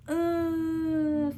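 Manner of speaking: long, high imitated contraction moan; mock groan held on one steady note; pained groan. Retelling her contractions to viewers, pained groan.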